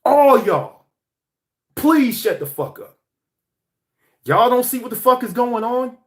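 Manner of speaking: exasperated shout, pitched high; fed-up outburst. Retold or imitated. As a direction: tell the whole world to shut up, fed up. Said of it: "I'm like".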